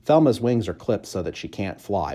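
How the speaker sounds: ordinary delivery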